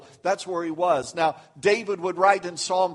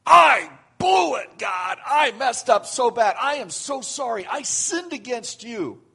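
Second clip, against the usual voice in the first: mock anguished confession; 5 semitones higher, 6 dB louder than usual. Preacher voicing the confession Jonah never made, anguished cry. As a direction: plead the sorry prayer Jonah never prayed.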